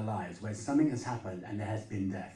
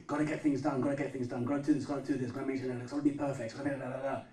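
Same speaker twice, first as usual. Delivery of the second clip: frantic busy Martha; 4 semitones higher, faster than usual. Retold or imitated. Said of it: "that kind of attitude and mindset".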